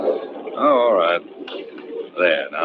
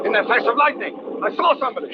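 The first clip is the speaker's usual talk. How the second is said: alarmed; 13 semitones higher than usual. Acted. Husband spots figure in lightning.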